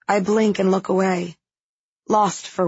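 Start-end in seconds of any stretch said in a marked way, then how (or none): none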